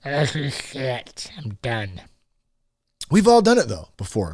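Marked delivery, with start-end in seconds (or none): 0.0-2.0 put-on slow, lightly slurred drunk vow fading off; a hint of a drunk man swearing it's his last night; drunk resolution voice. Host voicing a drunk New Year's vow, slow fading drunk voice. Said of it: "the drunk resolutions".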